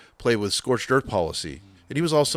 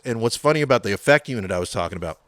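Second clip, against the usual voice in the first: ordinary talk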